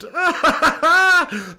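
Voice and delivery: high, loud burst of laughter. Real, not acted. Streamer cracking up at a viewer's Minecraft joke.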